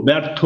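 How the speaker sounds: "ব্যর্থ" read as byar-tho; final o sound. Shown as a demonstration. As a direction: end "ব্যর্থ" on a clear o. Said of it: "উচ্চারণ হবে ওকারের মতো".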